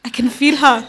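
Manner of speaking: wife in labor insisting to unbelieving husband, urgent; insistent urgent cry. Retold or imitated. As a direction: insist hard that the baby is here. Insisting baby is arriving.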